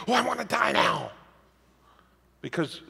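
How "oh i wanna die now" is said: hammed-up eagerness, pitched way up; over-the-top pretend glee, high-pitched; mock excitement. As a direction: squeal it as if jumping up and down. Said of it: "jumping up and down going".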